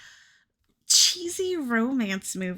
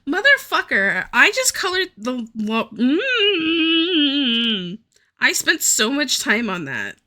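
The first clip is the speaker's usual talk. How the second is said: frustrated outburst; 3 semitones higher, 6 dB louder, slower than usual. Real, not acted. Ruined her coloring work.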